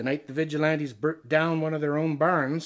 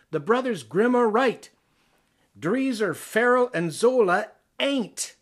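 louder and higher, each name punched; emphatic declaration; 6 semitones higher, 6 dB louder than usual. Acted. The poet declares fairy tales the winners, loudly emphatic. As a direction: proclaim it like a triumphant verdict.

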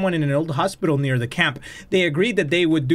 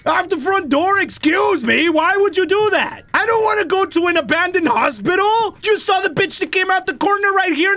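outraged yelling; 13 semitones higher than usual. Real, not acted. Refusing the hospital, yelling at game.

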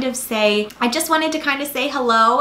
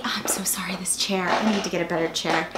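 whispered quote; 4 semitones lower, 6 dB quieter than usual. Retold or imitated. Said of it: "comments just saying".

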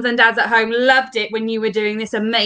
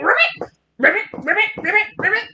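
ribbit like a jumping frog; playful leaping frog croaks; springy high ribbits; 8 semitones higher, faster than usual. Shown as a demonstration. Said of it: "our frog likes to jump".

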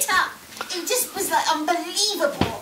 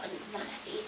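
a disgusted whisper, softer; 5 semitones lower, 14 dB quieter than usual. Real, not acted. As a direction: hush it out with disgust.

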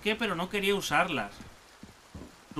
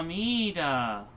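ordinary talk, nothing marked

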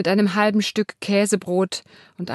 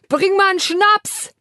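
high, loud bellowed order; bellowing; 13 semitones higher, 6 dB louder than usual. Acted. Martin bellows at his wife for schnapps, loud. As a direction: roar it at your wife. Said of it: "brüllt Martin seine Frau an".

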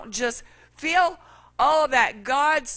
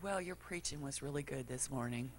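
soft, lower, sweetly knowing reply; gentle cashier; 8 semitones lower, 17 dB quieter than usual. Retold or imitated. Cashier gently telling the preacher she heard him, soft and knowing. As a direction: deliver it softly with a smile.

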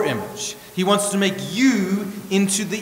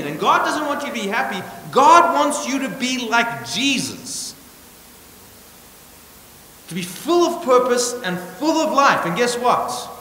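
raised, higher voice, hammering each point home; preaching at full force; 6 semitones higher, 4 dB louder than usual. Acted. Pastor to his church, preaching hard against the goal of happiness.

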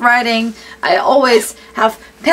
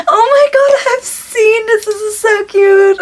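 high-pitched gushing burst; real excitement; 10 semitones higher, 3 dB louder than usual. Real, not acted. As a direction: start loud and high, gushing with delight. Thrilled by the gift.

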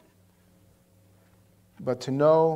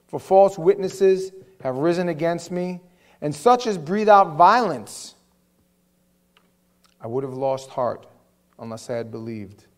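scripture reading; 7 dB louder than usual. Acted. Reading the psalm.

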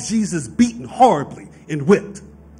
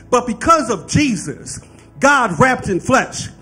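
rising, louder preacher's climax; preaching build; 4 semitones higher, 4 dB louder than usual. Acted. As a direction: lift the congregation toward the high point.

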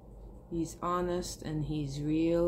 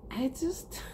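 voice breaking; 7 semitones higher than usual. Real, not acted. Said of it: "Trying not to get emotional".

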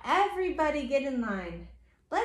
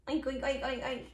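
soft, rapid string of oinks; short oinks in a row; pig oinks; 3 semitones lower, 6 dB quieter than usual. Acted. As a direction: oink like a little pig. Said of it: "Like a pig".

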